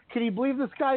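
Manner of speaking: high, disbelieving jab; mock outrage to a teammate; incredulous. [name] mocking the other guy.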